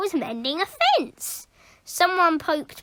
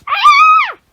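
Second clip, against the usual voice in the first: very high loud terrified scream; the whole class screams; 13 dB louder than usual. Acted. Whole class seeing the vampire, loud terrified scream. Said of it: "everyone shouted".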